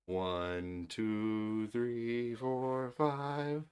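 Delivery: put-on counting softly to the baby; quiet slow counting to coax him. Shown as a demonstration. Father counting softly for baby to repeat. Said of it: "You say it".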